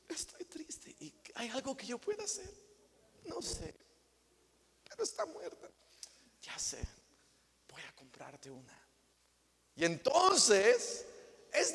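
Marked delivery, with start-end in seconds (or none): 0.1-5.7 much higher and much softer, mock grief; mock-sad softness. Tender mock-grieving exchange. 6.4-8.8 much softer, lower, comforting; husband softly comforting his wife; soft reassurance. Acted.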